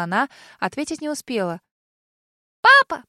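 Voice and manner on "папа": very high, loud happy shout; child shouting happily for dad; joyful child's yell. A narrator's reading. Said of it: "радостно заорал чей-то ребёнок".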